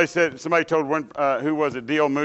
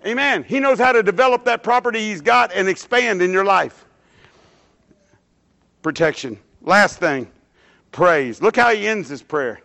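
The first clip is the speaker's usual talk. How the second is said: raised, emphatic sermon delivery; preaching; 9 semitones higher, 4 dB louder than usual. Acted. Preacher exhorting the congregation, loud and emphatic.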